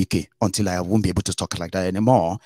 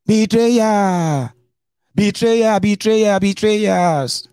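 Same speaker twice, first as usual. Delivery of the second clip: high, loud shouted accusation; mock furious shout; 12 semitones higher, 4 dB louder, much slower than usual. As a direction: scream it like a furious boss betrayed. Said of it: "That's what Were Wike is shouting now".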